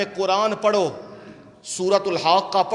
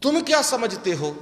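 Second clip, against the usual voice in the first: ordinary talk, nothing marked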